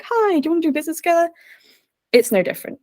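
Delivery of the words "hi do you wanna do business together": high, rushed hello to strangers; eager networking greeting. Pretend. Newcomer greeting a room of strangers, high and rushed. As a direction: pitch yourself fast in a high, perky voice. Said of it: "be like".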